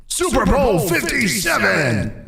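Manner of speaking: high, slow announcer call; sports announcer. Acted. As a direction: boom out the title. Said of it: "shouting and stuff".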